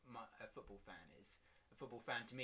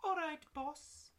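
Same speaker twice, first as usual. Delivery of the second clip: very high, slow cockney greeting; cheeky cockney player to his boss; 6 dB louder, slower than usual. Acted. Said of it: "Kyle is a bit cockney".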